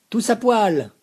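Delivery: high, loud leering shout; lewd joker. A narrator's reading. Dominique jokes to the group, leering shout. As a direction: bark the joke with a leer. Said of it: "son humour pas toujours au top".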